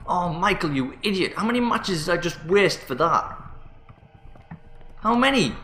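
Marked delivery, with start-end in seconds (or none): 5.0-5.5 exasperated. Real, not acted. Scolding himself over matches.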